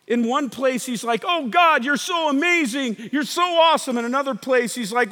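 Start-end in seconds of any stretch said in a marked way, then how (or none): 1.3-4.0 acted praise. Acts out David's elated praise.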